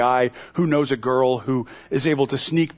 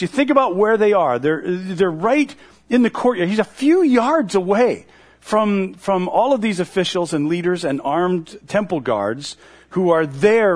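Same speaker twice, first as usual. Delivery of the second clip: ordinary delivery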